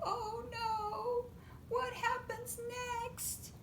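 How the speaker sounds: high tearful whimper; high weepy little voice; crying. Acted. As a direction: cry it high and helpless. Monkeys crying over book.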